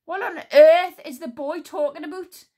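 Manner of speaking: high, loud sharp snap; snapped. Acted. Queen Sycorax snapping at the boy, loud and sharp. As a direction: bite the question off. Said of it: "snapped Queen Sycorax".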